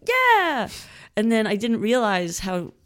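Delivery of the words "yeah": squealed high yes; thrilled, eager yes; excited yeah. Retold or imitated. Eager reply to shots.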